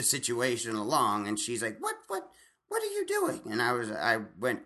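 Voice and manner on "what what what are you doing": very high mock gasp of outrage; indignant lady in the checkout line; shocked shopper. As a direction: squeak it out like an appalled lady.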